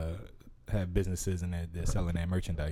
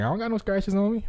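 son's voice; 11 semitones higher, 5 dB louder than usual. Retold or imitated. Son's voice retold.

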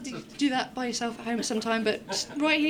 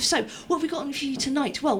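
ordinary talk, nothing marked

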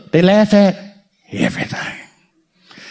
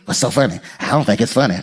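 lower, quieter, sour; a hint of a stern churchgoer; stern grouch; 9 semitones lower, 5 dB quieter, faster than usual. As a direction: sound like a humorless scold. Voicing stern serious person.

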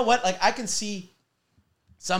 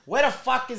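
a light New York wiseguy swear, pitched up; Joe Pesci voice; 7 semitones higher than usual. Retold or imitated. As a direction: toss it off like a movie mobster. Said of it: "the Joe Pesci kind of thing".

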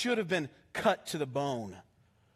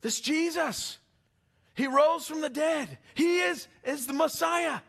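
yelling out; 8 semitones higher than usual. Retold or imitated. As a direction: shout it out like the apostles in the street. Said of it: "these guys start yelling out".

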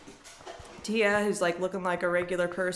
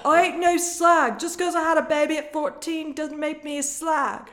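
put-on high, loud indignant British accent; British girl, loud and indignant; defensive British girl; 9 semitones higher, 5 dB louder than usual. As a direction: snap back as a British girl, much higher.

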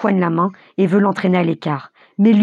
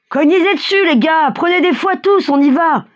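cri haut perché, entraînant; rally the gang in a high raised voice; appel à la foule; 9 semitones higher, 4 dB louder than usual. A narrator's reading. Cocher excite la foule au fouet. (voix haute qui harangue les gars)

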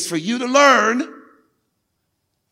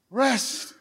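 calm divine reply; 2 semitones higher, 5 dB quieter than usual. Acted. God's calm answer voiced.

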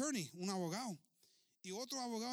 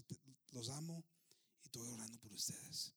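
half-whispered, low, tender words; hushed sincerity; 6 semitones lower, 7 dB quieter than usual. Acted. Chief quoting the dying husband to his widow, hushed sincerity. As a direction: drop to a low near-whisper.

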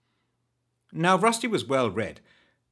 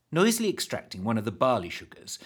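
usual speaking voice

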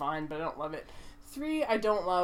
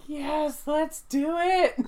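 higher, louder, slow yes let's do it; excited; 7 semitones higher, 6 dB louder, much slower than usual. Retold or imitated. Hearing they go to space, excited cheer. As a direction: cheer it on eagerly. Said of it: "And I was like".

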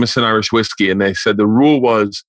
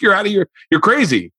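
mock dad outburst; 10 semitones higher than usual. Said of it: "He's like".

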